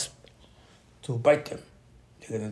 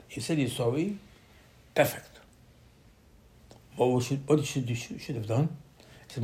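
ordinary talk, nothing marked

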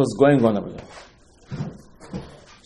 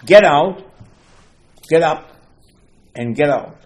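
loud, higher-pitched retold order; emphatic command; 3 semitones higher, 9 dB louder than usual. God sends Moshe out of mourning, loud emphatic command. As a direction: punch out each short command.

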